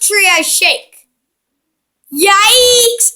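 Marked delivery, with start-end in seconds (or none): 2.1-3.1 startled yelp. Real, not acted. Tree shake startles him.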